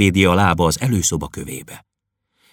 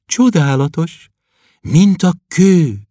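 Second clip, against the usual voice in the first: high, slow, reverent wonder; awed girl; 10 semitones higher, 3 dB louder, slower than usual. Acted. Klopédia touching his bicep, high slow awe. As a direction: marvel at his arm. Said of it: "áhítatosan".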